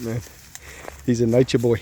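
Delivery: ordinary talk, nothing marked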